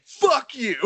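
high, loud hammed-up insult; high, loud retold taunt; mock yell. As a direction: snap from cheer to insult.

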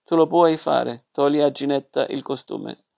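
usual speaking voice

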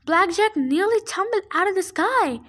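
usual speaking voice

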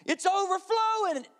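put-on high-pitched panicked kid voice; screaming son. Son calling his dad from the bathtub, high panicked kid voice. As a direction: cry out like a frightened little boy. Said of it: "my son starts screaming".

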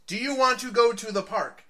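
frustrated owner yelling at his centipede. Retold or imitated. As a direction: shout the question at the jar. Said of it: "he yells".

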